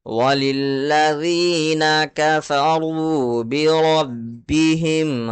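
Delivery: very slow, drawn-out tilawat with the shadda and madd sounds stretched; Quran recitation. Shown as a demonstration. Teacher recites the verse for viewers, slow and melodic. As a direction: chant the verse slowly as a reciter would. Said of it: "پھر سے ایک بار پڑھ لیتے ہیں".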